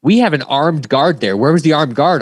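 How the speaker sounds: exasperated boss reliving it, raising his voice; retold angry outburst. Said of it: "And I'm getting pissed".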